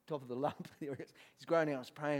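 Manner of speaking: normal talking voice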